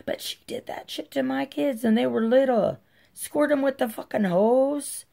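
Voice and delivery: high, heated outrage; indignant. Real, not acted. Anger over her kids.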